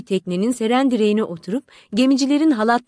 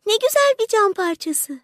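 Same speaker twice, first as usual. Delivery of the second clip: much higher, wondering exclamation; delighted cry; 10 semitones higher than usual. A narrator's reading. Little match girl finding a pretty bit of glass, exclaiming. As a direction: cry out high in delight, without extra volume. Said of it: "diye bağırdı".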